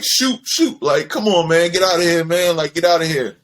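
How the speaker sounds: dismissive Michael Jordan. Retold or imitated. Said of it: "And Mike was like".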